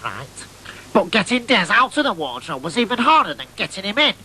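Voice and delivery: ordinary delivery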